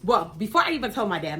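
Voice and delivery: ordinary talk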